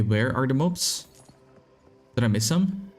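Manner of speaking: usual speaking voice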